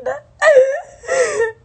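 loud high giggle; high giggling fit. Real, not acted. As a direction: break into giggles. Laughs after remark.

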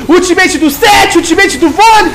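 hyped shouting teamfight call; fighting game announcer. Real, not acted. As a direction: scream the fight like an arena announcer.

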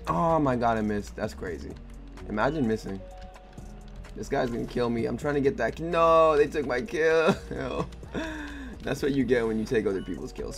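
ordinary talk, nothing marked

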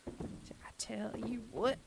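high, soft, drawn-out Southern twang; slow Southern lilt, rising; Southern drawl. Shown as a demonstration. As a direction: stretch it out in a quiet Southern accent. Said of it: "outside of the South".

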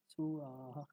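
সু আহা as 'suu-aaha', both vowels long; Sanskrit pronunciation. Shown as a demonstration. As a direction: glide from a drawn-out 'suu' into 'aaha' for সু আহা. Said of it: "তাহলে উচ্চারণটা হবে". (সু আহা, টেনে সংস্কৃত উচ্চারণে)

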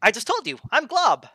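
high, bright-eyed newborn blob; high innocent ooze creature voice; high goofy blob voice. Acted. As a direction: chirp it like a friendly new creature. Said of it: "is like".

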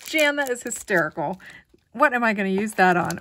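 loud delighted exclamation; excited laughing outburst. Real, not acted. Laughing at a friend's funny gift, loud and delighted. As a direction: burst out, tickled by the gift.

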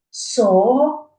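word broken into three loud beats; syllable by syllable. Shown as a demonstration. Teacher counting syllables for children, syllable by syllable. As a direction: sound out each syllable separately. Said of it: "скільки в слові складів".

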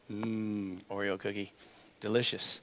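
very soft, low relish; hushed, dreamy cookie craving; savouring. Pretend. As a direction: murmur it as if tasting a treat. Savours an imagined Oreo cookie.

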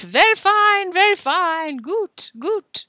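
high, loud loony cries; high-pitched nutty chirping; crazy boy act. Acted. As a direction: play a boy acting crackers. Boy acting crazy in story.

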